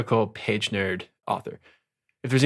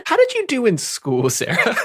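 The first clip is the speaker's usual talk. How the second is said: high, teasing jab at a friend; playful mock scolding, voice up high; 10 semitones higher than usual. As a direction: tease her like a snooty teacher. Teasing a cohost.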